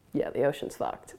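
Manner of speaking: a grim half-whisper at the end; under her breath. Real, not acted. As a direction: drop to a hushed, unvoiced aside.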